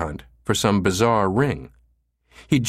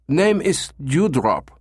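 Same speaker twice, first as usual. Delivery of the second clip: high, drawn-out German tourist voice; blurted German accent; 7 semitones higher, slower than usual. A narrator's reading. Caller blurting the girl's name, thick German accent. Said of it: "Becker blurted".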